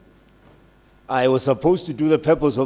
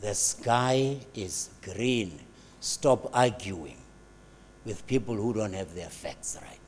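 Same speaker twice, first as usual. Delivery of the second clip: very slow, lower and softer, each word laid down; slow lion pronouncement; 4 semitones lower, 8 dB quieter, slower than usual. Retold or imitated. The lion settles the falsehoods about the university, very slowly. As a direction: deliver it like a weary final verdict. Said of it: "one day the lion will say".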